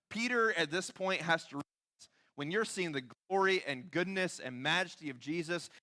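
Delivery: ordinary talk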